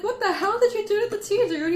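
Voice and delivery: high, loud mock outrage at the tea; shocked outburst. Retelling his reaction to Ken's motor-oil tea, high loud disbelief. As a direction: yelp it like you can't believe the cup.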